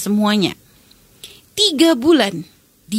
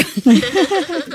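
short real laugh, a bit higher; 6 semitones higher than usual. Real, not acted. [name] laughs that her Arabic stalled. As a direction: laugh it off at the memory.

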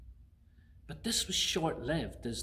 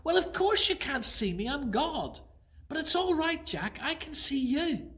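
lightly voiced calm God, a bit higher; God's voice; 12 semitones higher than usual. God answers Jack from above, calm. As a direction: reassure him, mildly amused. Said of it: "the voice came back again".

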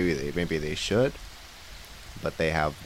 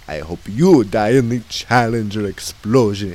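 laying a curse; 9 dB louder than usual. Acted. Clown curses the host.

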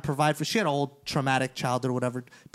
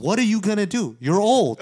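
higher, louder retold taunt; mocking taunt; 6 semitones higher, 6 dB louder than usual. Storyteller replays taunting an older rival on the phone, mocking. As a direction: throw the challenge back, breaking toward laughter.

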